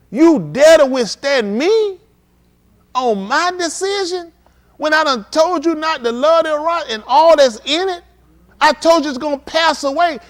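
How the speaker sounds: high, loud, slow stern rebuke; God rebuking the man who loves the world, loud. Acted. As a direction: thunder down like a judging God. God voiced rebuking him.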